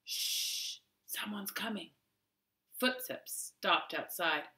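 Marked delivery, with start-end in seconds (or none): 0.0-1.9 breathless unvoiced whisper. A narrator's reading. Jane hiding from the men, warning Winnie in a whisper. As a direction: shush her friend without a sound. Girls hiding, man approaching.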